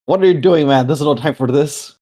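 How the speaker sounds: loud and high, snatching the bottle away; exasperated outburst. Acted. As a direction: shout it at a drunk friend. Grabs bottle, confronts drunk friend.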